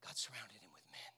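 low, soft whispery voice; reverent-sounding hush; hushed repeat. Real, not acted. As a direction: let it sink in, almost whispered. Same words repeated in a hush.

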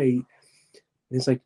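ordinary talk

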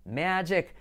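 "magic" slow, MEH-jek; pronunciación correcta. Shown as a demonstration. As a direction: draw out "magic" with short e vowels, hard j. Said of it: "Y no, es magic". ("magic" lento, «meee-dchek»)

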